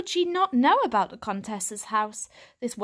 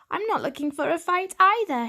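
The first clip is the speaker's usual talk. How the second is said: put-on higher, brighter boy cat voice, earnest; young tomcat; 9 semitones higher, 4 dB louder than usual. A narrator's reading. Lost young cat answering the stray, higher earnest voice. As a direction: pitch up for the young hero cat.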